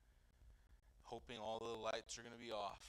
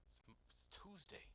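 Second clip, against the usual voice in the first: incredulous aside; 6 semitones higher, 12 dB quieter than usual. Retold or imitated. Protesting calling pastor.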